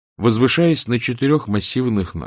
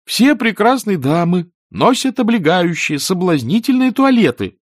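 put-on high, loud, know-it-all boy; self-assured youngster; 10 semitones higher, 5 dB louder than usual. A narrator's reading. Boy lectures the wizard on beautiful ladies, self-assured. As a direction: declare it cockily, pitched high. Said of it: "самоуверенно заявил Эрик".

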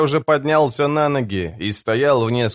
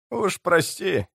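high, mild grumble; grumbling; 8 semitones higher, 4 dB quieter, slower than usual. A narrator's reading. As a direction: grumble it lightly, voice raised in pitch. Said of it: "проворчал Торвальд". (легко ворча)

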